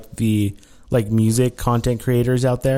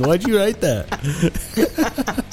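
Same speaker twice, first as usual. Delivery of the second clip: laughing disgust; 9 semitones higher, much slower than usual. Real, not acted. Reacting to biting joke.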